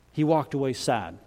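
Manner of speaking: ordinary delivery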